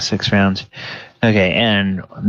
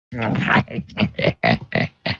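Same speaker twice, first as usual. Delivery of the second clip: wheezing hyena cackle; hyena giggle; 4 semitones lower, 5 dB quieter than usual. Acted. Gnoll cackles after its threat.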